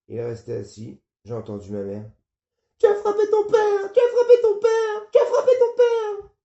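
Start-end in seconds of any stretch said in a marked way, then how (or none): 2.8-6.3 shrill, very high screaming mother. Acted. Mother screaming at her son for hitting his father. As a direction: scream it in horror, near tears. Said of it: "Elle gueulait".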